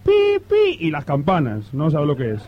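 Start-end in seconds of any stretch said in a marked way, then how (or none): 0.0-0.8 high little time-signal beeps; imitated beeps. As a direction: chirp out the radio pips. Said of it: "ahora ponemos los pitos".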